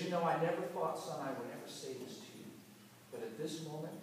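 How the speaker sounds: soft, low disappointed dad; father quietly telling his son he is embarrassed; hurt father. Retold or imitated. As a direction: quietly say the most painful words. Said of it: "the most damaging words".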